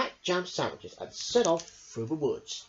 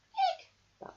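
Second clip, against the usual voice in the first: ordinary delivery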